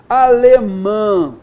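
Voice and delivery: "alemã" slow and loud, final "mã" held; stressed nasal syllable. Shown as a demonstration. Teacher showing the tonic syllable, "alemã" loud with drawn-out "mã". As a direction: lean long on the last syllable of "alemã". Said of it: "sílaba tônica com o an".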